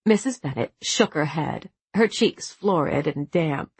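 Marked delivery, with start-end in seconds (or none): none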